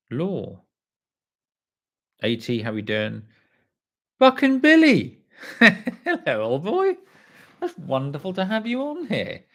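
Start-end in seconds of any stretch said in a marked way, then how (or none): 4.2-9.4 put-on high, theatrical old-chap voice; mock posh greeting. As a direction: greet them like a jolly old gentleman.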